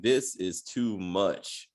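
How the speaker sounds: low, soft, slow deadpan disbelief; quiet unimpressed sigh of a line; exasperated. Retold or imitated. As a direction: drag it out, fed up. Reacting to Ruth's street talk.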